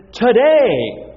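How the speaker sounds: "today" rung out high and loud; emphatic TODAY. Real, not acted. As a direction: proclaim "today" with a big falling swoop. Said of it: "will emphasize this word today".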